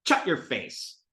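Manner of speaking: "Shut" said as "chut"; brother's "chut" for "Shut"; sh turned to ch. Retold or imitated. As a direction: start "Shut" with a ch. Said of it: "take sh sounds and make them ch".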